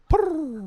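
high chirpy bird purr; parrot purr. Retold or imitated. Friend imitating the parrot's purr, high chirp.

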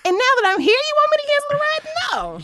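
retold outrage, voice shooting up high; indignant outburst. Refusing driver, indignant retold rant.

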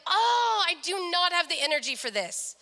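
exasperated high "oh", easing down; retold exasperation. Daughter dreading her dad's difficult friend, exasperated. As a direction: groan at having no energy for this.

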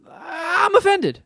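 high, slow, mock Irish accent; mock offended Irishman. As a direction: play a hammy offended Irishman. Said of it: "as an Irishman".

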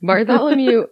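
Bartholomew said high and loud; overdone name reading. Pretend. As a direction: belt out Bartholomew with a flourish. Exaggerated name after laugh.

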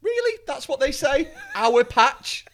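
high, loud disbelieving mockery; incredulous. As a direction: scoff at the phrase "Our patch" in disbelief. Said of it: "I remember thinking".

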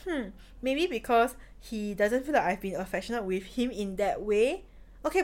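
ordinary delivery

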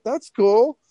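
high, loud mock teenage enthusiasm; impressed approval. Storyteller voicing himself at seventeen, high loud approval. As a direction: burst out high, playfully impressed. Acting out younger self's reaction.

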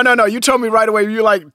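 ordinary talk, nothing marked

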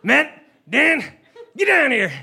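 high, strained call into the house; angry dad yelling. Acted. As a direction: summon the boys like a furious father. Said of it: "And he goes".

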